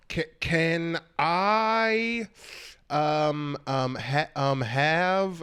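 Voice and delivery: very slow, stretched words with stumbles; halting order. Acted. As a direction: grope for each word as a struggling tourist. Tourist struggling to order.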